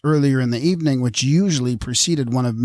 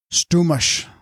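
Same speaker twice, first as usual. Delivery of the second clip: put-on drunken slur; 6 semitones higher than usual. A narrator's reading. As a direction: slur it like a tipsy nobleman. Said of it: "sounded a muffled growl".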